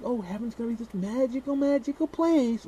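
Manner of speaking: high, gushing mock-dreamy voice; hammed-up starry-eyed believer. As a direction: gush like a naive believer. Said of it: "Everybody's like".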